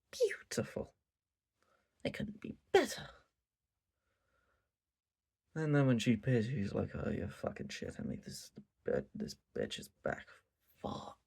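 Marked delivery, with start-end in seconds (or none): 0.1-3.1 high breathy awe, almost whispered; rapturous whisper. Real, not acted. Mock rapture over good scenes. 6.9-11.2 low, soft, under-the-breath grumble; disgusted mutter. Retold or imitated. Viewer groaning when she appears.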